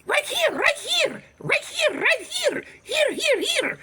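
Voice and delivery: very high squeaky mock-kid calls; repeated piping lookout cry; kids spotting. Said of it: "they spotting for him".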